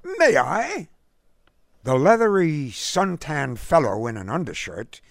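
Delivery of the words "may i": put-on high, clipped English gent's accent; lofty, clipped British accent. A narrator's reading. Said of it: "in his lofty, clipped accent".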